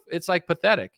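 normal talking voice

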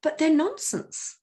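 high-pitched imitation of a baffled friend; incredulous. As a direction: retell her disbelief, pitched well up. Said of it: "she was just incredulous".

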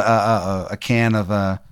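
usual speaking voice

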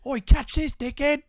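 loud, high Australian action hero yell; broad Australian accent. Pretend. Playing an Aussie Wonder Woman for his co-host, Australian accent shouted. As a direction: bark it in an over-the-top Aussie accent. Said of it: "You just really went for it".

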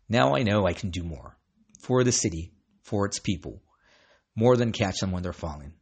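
normal talking voice

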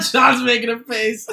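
real laughter through the words, high and loud; loud, high-pitched amused outburst; laughing. Real, not acted. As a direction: say it while cracking up. Laughing at co-host's reaction.

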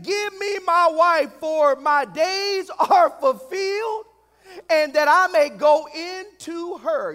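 loud, high, slow proclamation; preacher booming out an insistent demand; impassioned demand. Acted. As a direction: cry out each phrase like a claim overdue. Acting Jacob's demand from scripture.

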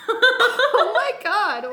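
loud, high, slow laughing cry; laughing shriek. Real, not acted. Both say the same words.

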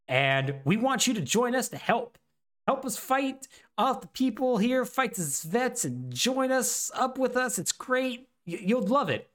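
high-pitched, coaxing recruitment plea; acted hopeful sales pitch; eager pitch. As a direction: sell the invitation, voice lifted high. Eager pitch voiced for Reeve.

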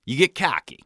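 plain reading voice

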